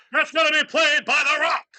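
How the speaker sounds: huge, high hype yell; excited shout. Acted. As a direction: announce it like an arena crowd. Hyping up the Rock casting.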